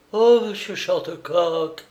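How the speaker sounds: high exclamation of recognition; astonished cry. A narrator's reading. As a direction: cry out in amazement at your rescuer. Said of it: "s'écria le jeune artiste".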